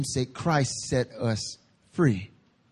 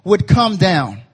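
high, emphatic sermon delivery; rising pulpit intensity; forceful preaching; 6 semitones higher, 5 dB louder than usual. Real, not acted. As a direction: preach it out, slowing on the key phrases.

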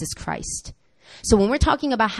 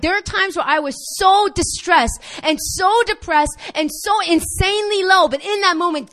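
impassioned preaching; 7 semitones higher than usual. Real, not acted. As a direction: preach it from the gut. Passionate preaching climax.

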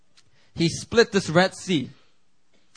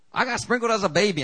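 high, quick mock congregant; mimicking congregant; 7 semitones higher, faster than usual. Preacher mocks a member's excuse, high and quick. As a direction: echo the excuse in a pitched-up mocking voice. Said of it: "some of you are asking me".

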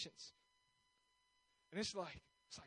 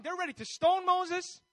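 ordinary delivery

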